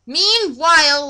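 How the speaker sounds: ordinary delivery, nothing marked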